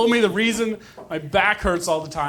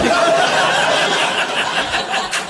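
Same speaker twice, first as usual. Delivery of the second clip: crowd laughter; 11 semitones higher than usual. Real, not acted. Audience laughs at flub.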